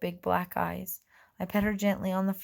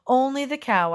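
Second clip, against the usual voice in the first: louder, higher nervous fib; loud bluff; 6 semitones higher, 7 dB louder than usual. A narrator's reading. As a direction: bluff loudly to fool him. Fairy bluffs loudly.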